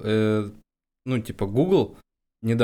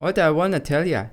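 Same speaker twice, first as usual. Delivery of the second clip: put-on Yankee accent; 4 semitones higher than usual. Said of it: "янки, да".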